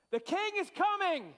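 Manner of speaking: high, loud announcement of the king; loud proclamation. Acted. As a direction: proclaim it to the whole room. Cueing the crowd response.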